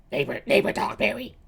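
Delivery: put-on a frail old man calling Dogberry; old Verges voice. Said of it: "He's kinda old".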